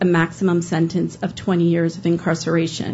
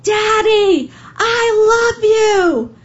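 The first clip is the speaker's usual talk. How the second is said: put-on high, loud, drawn-out child's scream; 5 dB louder, much slower than usual. Little girl screaming to her dad through the prison window, high and drawn out. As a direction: yell it high and long through the window so he hears. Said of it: "she screams".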